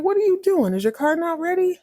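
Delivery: high-pitched imitation of a puzzled coworker; mock incredulous questioning. Karen finding Joe waiting in her office, mock incredulous.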